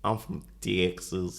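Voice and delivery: slow, badly faked Texas twang; terrible Texas accent. Shown as a demonstration. Co-host put on the spot to show his Texas, badly faked Texas accent. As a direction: attempt a Texas drawl and miss. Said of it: "That was actually terrible".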